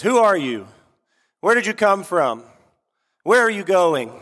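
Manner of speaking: high-pitched, booming challenge; big booming voice. Retold or imitated. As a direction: call out like a sentry challenging a stranger. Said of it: "big booming voice".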